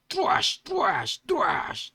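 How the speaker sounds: rapid series of vocal slashes; repeated explosive stab effects; imitated stabbing noises. A narrator's reading. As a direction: punch out each blow like a sound effect. Imitates repeated knife blows.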